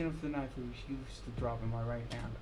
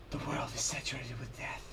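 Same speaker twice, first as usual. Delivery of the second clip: soft, unvoiced, awed; hushed whisper; 4 semitones lower, 6 dB quieter than usual. Fighter whispering as he senses death everywhere. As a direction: breathe it out.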